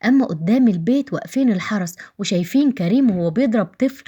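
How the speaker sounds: plain reading voice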